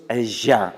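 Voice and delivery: "el ya" slow, with a buzzing zh; voiced final consonant. Shown as a demonstration. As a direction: demonstrate "ya" slowly as voiced zha. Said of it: "Y esta es sonora". ("ya" sonora, dicha despacio)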